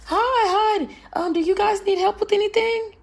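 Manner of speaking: high, chirpy eager-clerk voice; mock saleslady. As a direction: play the overeager saleslady greeting the couple.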